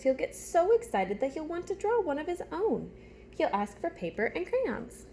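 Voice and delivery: put-on animated storytime voice for young listeners; reading a picture book aloud. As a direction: read it like story time with little ones. Reads picture book to class.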